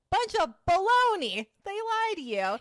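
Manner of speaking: high, loud scornful dismissal; scoffing retort. Retold or imitated. Mocking coworker's doctor advice.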